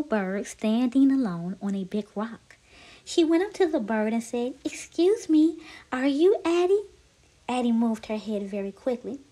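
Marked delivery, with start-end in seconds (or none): 4.6-6.8 higher, tentative question; small, hopeful character voice; polite little snake. Acted. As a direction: ask it high and politely. Lucy approaches the bird.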